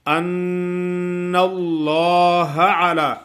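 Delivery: slow, loud, high chant with a held ghunna; tajweed recitation. Acted. Teacher recites the verse for students, loud tajweed chant. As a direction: recite slowly with the nun held.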